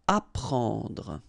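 "apprendre" said ah-PRAHN-druh, the en nasal; model word. Shown as a demonstration. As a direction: built up from "prend" to the full word. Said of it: "this nasal en".

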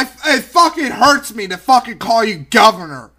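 usual speaking voice

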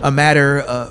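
ordinary delivery

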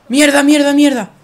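loud frustrated cursing. Real, not acted. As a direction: shout the curses as it goes wrong. Spotted by enemies in game. (maldiciendo a gritos)

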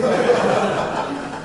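loud breathy burst of laughter; joyful laugh. Real, not acted. Preacher laughing at flowers greeting him, joyful. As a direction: laugh with delight at the memory.

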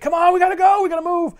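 high-pitched urgent plea; retelling a panicked hurry-up; acted urgency. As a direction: urge someone up and out, voice jumping high. Retold man urging her to flee.

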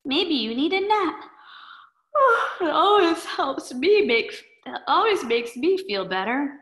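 put-on high, sighing sleepy girl voice; a drowsy girl suggesting a nap; sleepy Schluffy Girl.

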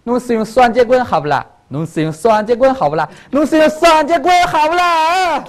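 Shanghainese lilt, last repeat pitched high; Shanghai dialect. Shown as a demonstration. Showing a child the song in Shanghainese, Shanghai accent. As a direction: put on a Shanghai accent, building to a high finish. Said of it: "上海话怎么说呀？".

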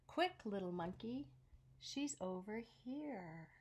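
usual speaking voice

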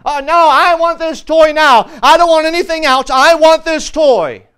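put-on loud bratty child demanding the toy; whiny little girl. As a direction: wail it like a spoiled little girl. Girl tantrum in store.

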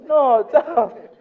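Italian laughing at the misunderstanding; laughing correction. Retold or imitated. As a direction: brush off the mix-up with a laugh.

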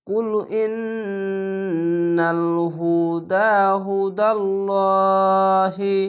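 slow, chanted recitation; Quran recitation. Shown as a demonstration. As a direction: recite the verse in a slow, held tune. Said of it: "তো এখানে হি পড়লাম". (ধীর কুরআন তিলাওয়াত)

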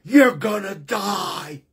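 slow, shouted death sentence; a doctor's cold, demonic verdict; menacing doctor. Retold or imitated. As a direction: deliver it like a curse, slow and loud. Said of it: "in this demonic look".

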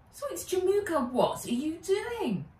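put-on high, quick girl voice; confused girl. A narrator's reading. Nat asks her guardian what he is doing: high, quick girl voice.

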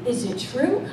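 milk the drama, lightly; dramatic queen. Retold or imitated. Preacher as a queen questioning the boys, mild drama. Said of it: "I might have had that drama".

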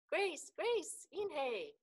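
high, loud name-calling; excited high-pitched shout. A narrator's reading. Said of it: "shouted Ralph".

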